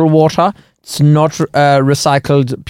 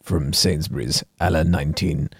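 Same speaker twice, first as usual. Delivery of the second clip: low, slow, hushed connoisseur; Martin Rice; 9 semitones lower, 8 dB quieter than usual. Acted. Host plays water sommelier presenting a rare bottle, slow solemn reverence. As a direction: savour each phrase with long reverent pauses. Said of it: "Martin Rice, water sommelier".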